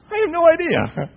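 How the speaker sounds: very high, loud squawk of bafflement; hammed-up exasperated outburst; comic helplessness. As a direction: throw up your hands vocally. Stumped dad, comic outburst.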